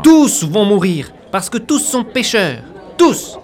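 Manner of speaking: slow, loud doom shout, final cry high; unhinged villain. Acted. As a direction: hammer out "tous" like a fanatic. Doom rant after question.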